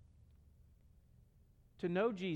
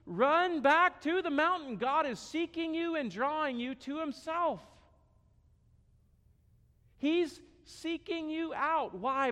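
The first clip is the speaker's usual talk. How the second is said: rising, louder preacher's appeal; preaching at full force; 5 semitones higher, 3 dB louder than usual. Acted. Preacher pleading with the congregation, building in force. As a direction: build the plea toward the mountain call. Pastor urging congregation to respond.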